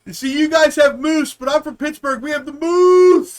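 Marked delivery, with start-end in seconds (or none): 2.2-3.4 hammed-up boast. Bragging in put-on voice.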